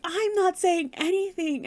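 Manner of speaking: high, loud flustered protest; protesting denial. Retold or imitated. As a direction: insist on innocence, pitched right up. Ruby protests in acted quarrel.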